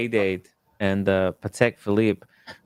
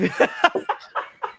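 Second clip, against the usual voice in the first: high breathy giggle. Real, not acted. Laughs at his own joke.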